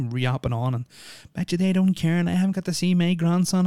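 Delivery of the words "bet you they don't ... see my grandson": high, mocking gossip voice, much higher; mocking Karen. Mimicking the Karen walkers gossiping about young ones, high mock voice. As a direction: sneer it like a nosy busybody.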